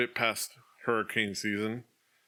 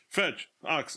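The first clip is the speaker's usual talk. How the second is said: higher-pitched mock calling; imitated summoning calls; 6 semitones higher than usual. Imitating the official recruiting townsfolk, mock calling out. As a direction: mimic someone summoning helpers by name. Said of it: "He just, like, grabs and points".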